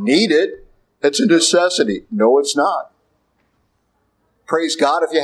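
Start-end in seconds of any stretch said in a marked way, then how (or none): none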